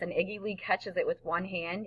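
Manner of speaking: plain reading voice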